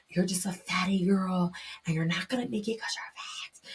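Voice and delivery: whispered negative self-talk; inner critic. Retold or imitated. Inner voice putting herself down, hushed whisper. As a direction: whisper it like a nagging thought. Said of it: "hoards in the back of my mind".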